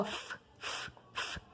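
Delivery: "F, f" as pure air, a soft hiss through the teeth; just let the air out on "f"; voiceless F sound. Shown as a demonstration. Teacher showing the child the airy F sound. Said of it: "Sai ventinho".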